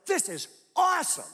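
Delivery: high, loud delighted cry; a gleeful exclamation about the crisis; thrilled. Retold or imitated. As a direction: burst out with glee at the trouble. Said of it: "I'm like".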